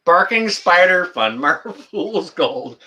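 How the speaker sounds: slow, high words breaking up into laughter; real laughing. Real, not acted. Streamer reading a chatter's joke, laughing hard. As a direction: lose it while reading out the name. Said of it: "You got me laughing here".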